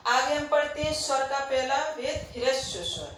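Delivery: ordinary talk, nothing marked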